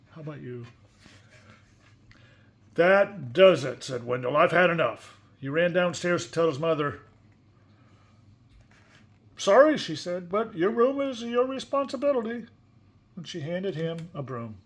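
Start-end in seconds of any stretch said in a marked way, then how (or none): 2.7-5.2 put-on fed-up boy. Wendell fed up with pigs. 9.4-12.5 higher, louder firm mother; brisk mom laying down the rule; mother's voice. Acted. Mother refusing to help Wendell.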